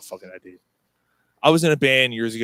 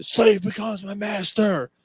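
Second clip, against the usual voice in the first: higher, drawn-out mock metal cry; imitating screamed lyric; 8 semitones higher, slower than usual. Friend at the concert yelling along to End of the Line, high mock scream.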